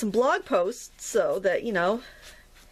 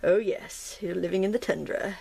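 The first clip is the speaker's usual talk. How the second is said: ordinary talk, nothing marked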